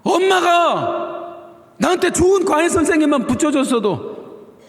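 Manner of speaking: put-on high whining grown child blaming mom. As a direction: complain to mom like a spoiled kid. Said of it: "뭐라고 얘기해요?". (엄마 탓하는 자식의 높은 투정)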